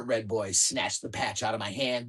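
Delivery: ordinary delivery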